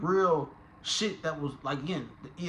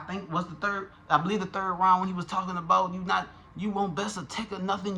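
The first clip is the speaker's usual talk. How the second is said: normal talking voice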